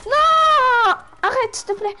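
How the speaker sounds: pleading shout. Real, not acted. Pleading in-game with teammate.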